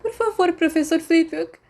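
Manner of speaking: put-on high, tearful plea of a girl; sobbing plea. Girl begging the professor to let her go home, sobbing. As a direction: beg through sobs. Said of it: "Tina soluçou".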